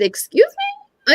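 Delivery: very high, drawn-out slow "Excuse me?"; shocked disbelief. Retold or imitated. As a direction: stretch it out in stunned disbelief. Hears boyfriend is dead.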